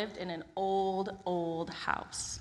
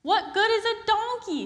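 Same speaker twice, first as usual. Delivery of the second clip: high, loud, exasperated question; exasperated Peter; 14 semitones higher, 7 dB louder than usual. Acted. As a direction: squeak in disbelief. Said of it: "said Peter".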